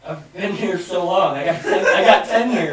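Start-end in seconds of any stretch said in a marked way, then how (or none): 1.4-2.7 laughing. Real, not acted. Laughing at own tenure joke.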